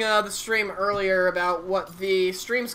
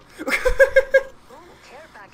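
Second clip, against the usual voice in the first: high burst of laughter; 7 dB louder than usual. Real, not acted. Laughs at own joke.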